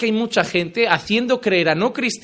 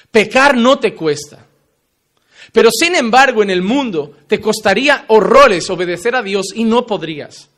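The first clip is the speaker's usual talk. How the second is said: loud, driving sermon delivery; fiery preaching; 4 semitones higher, 7 dB louder than usual. Acted. Preacher confronting his congregation, loud and forceful. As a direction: pound each contrast home like a revival preacher.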